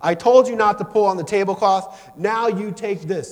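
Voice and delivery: loud, high scolding mom; acted maternal fury, slowing on the threat; angry mother. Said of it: "And in her anger".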